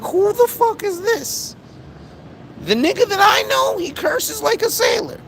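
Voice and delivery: an exaggerated squeaky God in disbelief; outraged God. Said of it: "God, again, is gonna be like".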